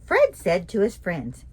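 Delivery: ordinary delivery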